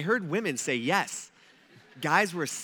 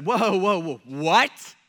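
loud, startled whoa whoa what; a crowd caught off guard, louder; shocked crowd; 7 dB louder, much slower than usual. Retold or imitated. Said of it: "And everybody's just like".

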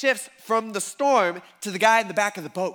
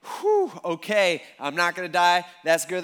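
long exhaled whew, then relieved talk; sigh of relief; 5 dB louder than usual. Retold or imitated. Disciples realising they will not die, relieved. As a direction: let the relief out in a big whew. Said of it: "sigh of relief".

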